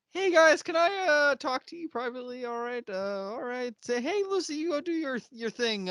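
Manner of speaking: high, fussy put-on character voice; hammed-up polite brush-off, pitched high; imitated awkward interruption. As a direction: cut in high, trying to steer everyone away. Voices character hurriedly steering guests away.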